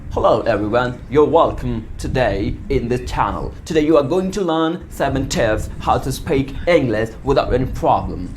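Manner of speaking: low, slow British English accent. Shown as a demonstration. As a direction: speak it like an Englishman in RP. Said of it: "अंग्रेज लोग होते हैं वो अंग्रेजी ऐसे बोलते हैं".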